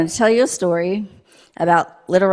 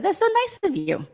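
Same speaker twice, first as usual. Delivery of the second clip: put-on much higher, sweet and grateful little girl; 13 semitones higher, 4 dB quieter than usual. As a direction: lift into a high, delighted girl's voice.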